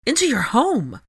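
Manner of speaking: high, loud indignation; disgusted outrage. A narrator's reading. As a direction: finish the accusation in appalled disbelief. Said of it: "disgust clearly etched on her features".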